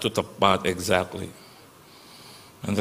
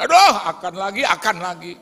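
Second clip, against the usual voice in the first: exasperated groan; 8 semitones higher, 4 dB louder than usual. Retold or imitated. Groaning at akan.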